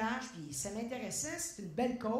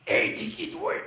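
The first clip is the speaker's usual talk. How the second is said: loud imitation of a brusque singer; gruff challenge; 5 semitones higher, 9 dB louder than usual. Singer asking the unknown dancer who he is, brusque. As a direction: lightly mimic a star challenging a stranger.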